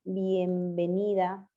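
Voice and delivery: bienvenida drawn out slowly, syllable by syllable; teacher dictating a word to transcribe, slowly; slow dictation. Shown as a demonstration. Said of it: "Si yo les pongo".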